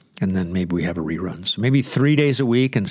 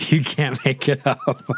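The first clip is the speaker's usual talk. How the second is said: said through a laugh, slowly; amused, drawn out; 5 semitones higher than usual. Real, not acted. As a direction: laugh while you say it. Laughing at cuddling video.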